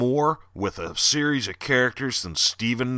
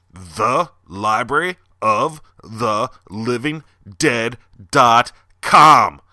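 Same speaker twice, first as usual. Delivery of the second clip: slow, loud, spelled out for emphasis; slow emphatic URL read; drawn-out web address; 6 dB louder, slower than usual. Shown as a demonstration. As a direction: stretch every word so they remember it. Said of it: "Get it?".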